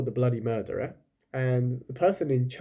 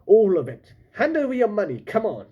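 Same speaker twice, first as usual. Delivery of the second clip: loud and much higher; blustering pirate demand; 8 semitones higher, 5 dB louder than usual. Acted. Pirate captain threatens Lila for her money, loud. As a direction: bark out the threat.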